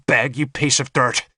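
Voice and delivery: contemptuous, contained snarl. A narrator's reading. Nimue taunting her enemy the bishop, snarled. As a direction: snarl it without raising the volume. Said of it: "she snarled at him".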